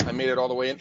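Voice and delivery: ordinary talk, nothing marked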